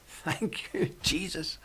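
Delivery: breathy laugh through the thanks; laughing quietly. Real, not acted. Off mic to himself, laughing softly.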